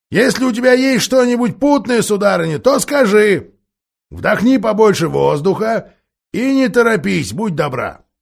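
dwarf annoyed at the shouting; grumpy deaf dwarf. A narrator's reading. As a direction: grumble like an irritated old dwarf. Dwarf to owl.

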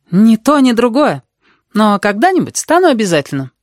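put-on high-pitched girl's defensive answer; red-haired girl, gloomy. A narrator's reading.

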